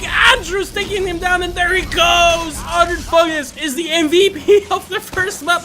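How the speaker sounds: straining a hoarse voice. Real, not acted. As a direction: voice cracking from shouting. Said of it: "I'm losing my voice".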